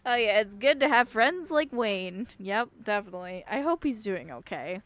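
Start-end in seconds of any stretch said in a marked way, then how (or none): none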